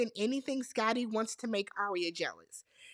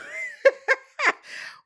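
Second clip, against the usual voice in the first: high-pitched cackling laugh; real squealing laugh; 6 dB louder than usual. Real, not acted. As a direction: burst into high, loud giggles after a whoop. Laughs after the whoop.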